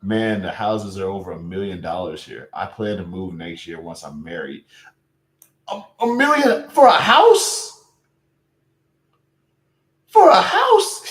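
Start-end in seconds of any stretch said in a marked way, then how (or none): 5.7-11.1 much higher, louder and slow, stretched-out outrage; loud, drawn-out cry of disbelief; shocked disbelief. Real, not acted. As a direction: blurt it out stunned at the price. Streamer shocked at house price.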